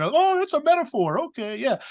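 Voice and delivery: airy sarcastic relief; mock relieved shrug. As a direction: toss it off like a dismissive skeptic. Mocking a relieved symbolic reading.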